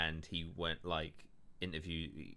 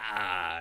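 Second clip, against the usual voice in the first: drawn-out mock yell, louder than talk; imitated possessed cry; 6 dB louder than usual. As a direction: imitate a demon leaving with a long "aah". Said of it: "goes like".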